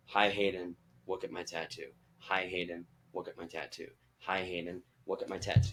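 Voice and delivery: low, soft repeated mutter; rehearsing under the breath. Retold or imitated. Fan planning what to say to a celebrity, low muttered rehearsal. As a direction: mumble it low, like memorising a line.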